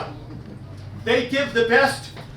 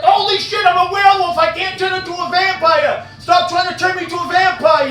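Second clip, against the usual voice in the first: panicked yelling; 5 semitones higher than usual. Retold or imitated. Werewolf yelling at vampire buddy biting his neck, panicked. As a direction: yell it like he's biting your neck. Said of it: "I'm like".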